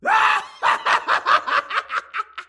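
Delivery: booming maniacal laugh; villain laugh. Acted. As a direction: howl with evil glee. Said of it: "I really like your villain voice".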